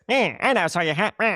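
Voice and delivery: a lightly voiced bird; a hint of a squawky little bird. Dad reading the hat book, doing the bird, lightly voiced. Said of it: "A bird's gonna sound like this".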